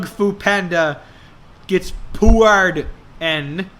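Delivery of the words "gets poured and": usual speaking voice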